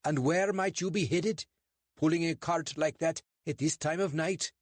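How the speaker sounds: a suspicious bobby lecturing two suspects; portly constable, stern and clipped; English constable. Acted.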